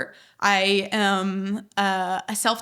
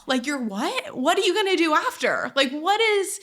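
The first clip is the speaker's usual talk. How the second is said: higher-pitched mock disbelief; incredulous parent; 7 semitones higher than usual. Daughter mimicking parents questioning her plan, incredulous. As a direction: imitate a baffled parent demanding answers.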